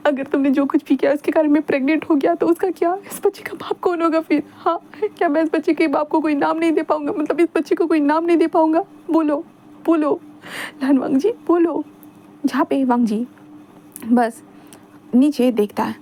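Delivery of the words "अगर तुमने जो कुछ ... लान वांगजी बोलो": put-on lightly higher pleading young man; clutching his leg, needy and dramatic; Wing pleading. A narrator's reading. Wing clutches Wangji's leg, begging him to answer, higher pleading.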